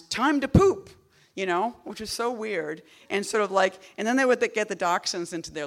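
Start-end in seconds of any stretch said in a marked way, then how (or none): 0.0-0.9 high, loud household announcement; mock proclamation, pitched up and loud; announcing. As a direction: call it out to a pack of dogs. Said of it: "they would, like, announce".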